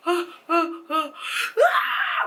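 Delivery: high gasping cries building to a loud scream; short high yelps, then one big acted scream; mock screaming. Found missing girl answers what happened, screaming. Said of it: "like, screaming".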